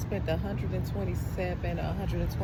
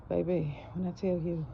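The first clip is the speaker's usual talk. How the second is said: breathless after carrying groceries; out of breath; 6 dB quieter than usual. Real, not acted. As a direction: talk between breaths. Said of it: "I'm out of breath".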